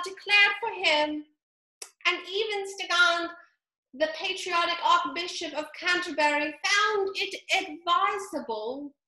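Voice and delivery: high lecturing mouse voice; pompous mouse. A narrator's reading. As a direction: carry on the dry history lesson proudly.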